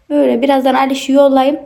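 normal talking voice

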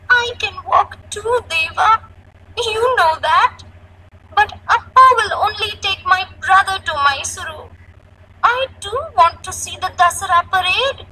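put-on high-pitched, upset little girl; sobbing child. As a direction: wail the complaint like a hurt child.

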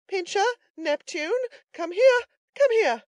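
very high, loud calls to dogs; tremulous calling. A narrator's reading. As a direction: call the dogs back nervously. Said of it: "cried a tremulous voice in the rear".

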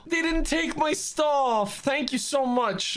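high, drawn-out joyful relief; relieved delight. Real, not acted. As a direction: stretch it out, high and grateful. Finds belongings were left behind.

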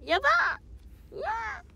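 high, drawn-out squeal; high flustered squeal of delight; excited squeal. Real, not acted. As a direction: shriek with delighted embarrassment. Told a team wants her.

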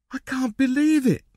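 high, astonished gasp; amazed, pitch shooting up. A narrator's reading. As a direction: gasp it in disbelief. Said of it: "he gasped".

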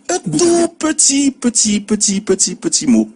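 high, slow drawn petit, petit, petit; tiny word stressed. Shown as a demonstration. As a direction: stretch the petit again and again for effect. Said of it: "Deux syllabes".

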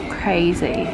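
usual speaking voice

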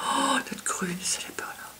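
soft excited whisper; hushed delight at the green shade. Real, not acted. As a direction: whisper it, half to yourself. Excited whisper over eyeshadow green.

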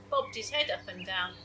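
ordinary delivery

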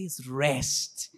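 low, soft, very slow; restful. Shown as a demonstration. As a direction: breathe it out like letting go. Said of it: "say it in a restful way".

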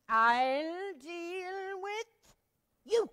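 slow, loud threat; furious. Acted. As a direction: drag the threat out.

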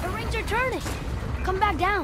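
excited call. Acted. Boy excited at turning rings.

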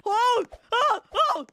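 short, loud, high yelps; sudden frightened oh; startled yelps. Real, not acted. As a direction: yelp in alarm three times. Startled by attacker in game.

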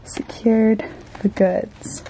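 slow breathy whisper; whispered aside. Real, not acted. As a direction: hush it like a secret. Hushed triumphant aside.

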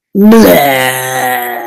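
low, crying blub with a sob; the pout fish bursting into mock tears; sobbing blub. A narrator's reading. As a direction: let the last blub break into a sob. Fish's last blub sobbed.

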